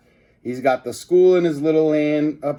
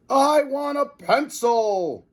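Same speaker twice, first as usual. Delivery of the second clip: high, slow demanding scream; screaming professor; 13 semitones higher, slower than usual. A narrator's reading. Professor screaming his demand at the reporter. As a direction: shriek it like a mad villain. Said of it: "screamed Professor Poopy Pants".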